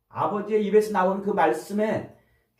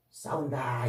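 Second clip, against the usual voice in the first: whisper; 5 semitones lower, 6 dB quieter, slower than usual. Real, not acted. Preacher whispers the verse word.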